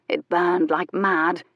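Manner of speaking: plain reading voice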